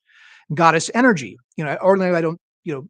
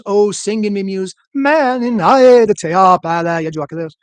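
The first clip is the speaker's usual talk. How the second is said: epic recitation; 5 dB louder than usual. Pretend. Reciting the Iliad opening.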